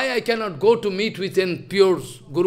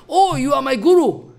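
higher, louder retold exclamation; acted exclamation of recognition; 5 semitones higher, 5 dB louder than usual. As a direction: exclaim it as if recognising your guru. Acted disciple recognising his guru.

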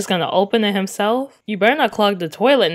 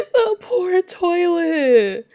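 slow, high tearful mock pity; laughing wail; 6 semitones higher, much slower than usual. Real, not acted. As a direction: whimper it through suppressed laughter.

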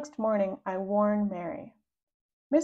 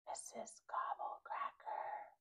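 breathless spooky whisper, slow; eerie hushed whisper of a feared name; 18 dB quieter, slower than usual. Shown as a demonstration. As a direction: hiss the name as if she might hear. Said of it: "you have to whisper when you say her name, like this".

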